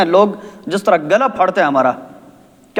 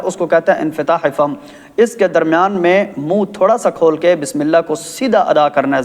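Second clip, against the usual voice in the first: ordinary delivery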